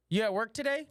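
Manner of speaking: high-pitched mock mom; mom texting. Mom texting son about work, high mock-casual voice. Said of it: "my mom will text me".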